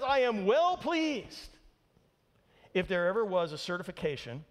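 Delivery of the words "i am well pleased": slow, higher and louder divine proclamation; lightly booming heavenly voice, drawn out; booming voice of God. Retold or imitated. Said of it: "God says in this great voice".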